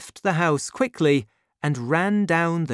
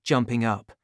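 plain reading voice

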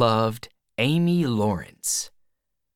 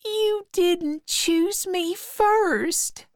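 very high, slow wail; crying girl; slower than usual. A narrator's reading. Becky upset she was not chosen first, crying high voice. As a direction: wail it slowly, high and wounded. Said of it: "she began to cry".

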